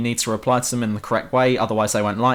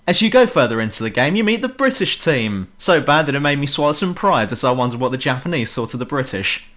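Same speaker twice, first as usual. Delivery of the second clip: ordinary talk, nothing marked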